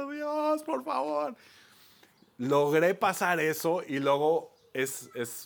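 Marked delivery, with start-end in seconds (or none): 0.0-1.3 high, sobbing plea to God; crying. Retold or imitated. Exhausted climber begging God mid-climb, high sobbing plea. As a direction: wail it out as if near tears. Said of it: "venía llorando".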